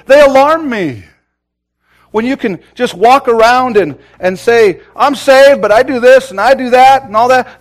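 fiery sermon climax; preaching at full force. Real, not acted. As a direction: drive it home from the pulpit.

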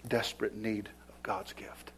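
sudden hushed whisper. Acted. Preacher hushing the church on their need, whispered. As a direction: drop to a whisper for weight. Sermon drops to hush.

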